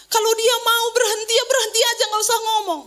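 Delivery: high, insistent complaint; acted-out fed-up objection; exasperated grumbling. Acts out family's exasperated grumbling.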